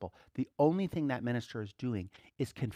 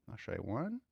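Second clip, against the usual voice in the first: usual speaking voice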